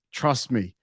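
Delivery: normal talking voice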